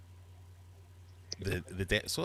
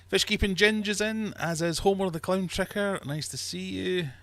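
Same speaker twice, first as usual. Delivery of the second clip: usual speaking voice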